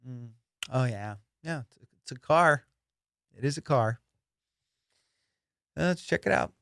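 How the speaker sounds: acting unexcited. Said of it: "I never act excited".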